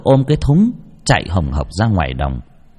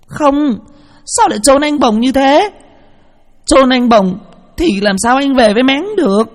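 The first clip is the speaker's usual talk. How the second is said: high, tearful protest; crying child; 3 dB louder than usual. Acted. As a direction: wail at them burying her brother. Child cries at the burial. (giọng bé gái khóc gào)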